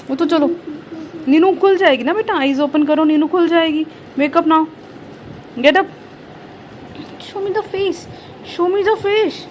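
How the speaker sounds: high, lively wake-up coaxing. Real, not acted. Mother telling her child to open eyes, high coaxing baby talk.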